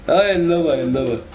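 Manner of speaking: high, real laughing delight; laugh, then talk through it high. Real, not acted. Player amused at getting lost, real laughing delight. Laughing at own imitation in game.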